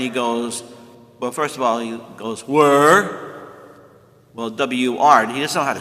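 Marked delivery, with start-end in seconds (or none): 2.5-3.5 lightly voiced caveman asking where, rising; a hint of a grunting Stone Age man; playful caveman question, louder; Flintstone voice. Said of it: "bear with me as I do my impersonation of Flintstones".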